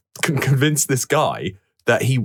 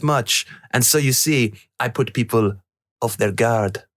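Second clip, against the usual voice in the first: put-on French accent, pitched up; 5 semitones higher than usual. Co-host reading Poirot explaining his trick, in a put-on French accent. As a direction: play the detective with an imitation French accent. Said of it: "in your French".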